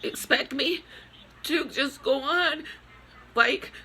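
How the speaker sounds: high, tearful voice breaking through sobs; real grief pouring out, voice cracking upward; crying. Real, not acted. Grieving mother breaks down crying.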